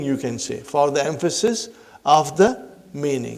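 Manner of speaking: ordinary delivery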